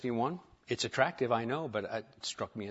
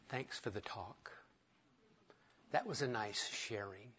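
dismissive churchgoer; 4 semitones lower, 13 dB quieter than usual. Retold or imitated. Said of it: "If you'd like to offend a pastor".

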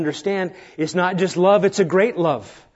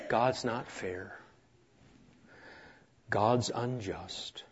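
slow, quiet grumbling complaint; a soft, drawn-out fool's complaint; foolish talk; 7 semitones lower, 14 dB quieter, much slower than usual. Retold or imitated. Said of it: "that's talk like a foolish person talks".

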